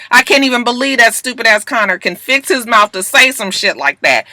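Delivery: ordinary talk, nothing marked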